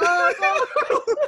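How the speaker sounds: high, loud laughing groan. Retold or imitated. Watching trailer with coworker, high laughing oh no. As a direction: crack up mid-groan. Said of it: "we were cracking up".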